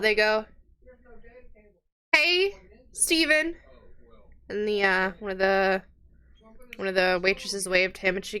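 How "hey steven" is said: drawn-out, higher-pitched hail; called-out greeting. Acted. Commoners greet a friend at the tavern, mild shout. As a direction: call out across the room, stretching the words. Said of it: "You hear them shout".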